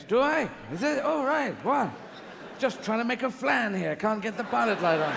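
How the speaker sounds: high, loud, startled innocence; clueless God. Retold or imitated. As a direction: play a God caught out, baffled. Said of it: "he lives in a caravan".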